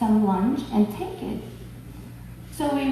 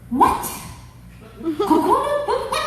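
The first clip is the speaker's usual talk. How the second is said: incredulous; 10 semitones higher than usual. Retold or imitated. Incredulous reply to father.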